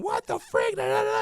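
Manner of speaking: high, loud mock yell; mock yelling girlfriend. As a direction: play the girlfriend yelling down the phone. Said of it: "she started yelling at me".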